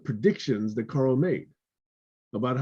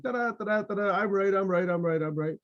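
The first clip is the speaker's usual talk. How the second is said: high, sing-song gloating chant; gleeful I'm right singsong; gloating donkey; 7 semitones higher than usual. Retold or imitated. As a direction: taunt it like a smug donkey trotting off. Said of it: "gallops away, all happy".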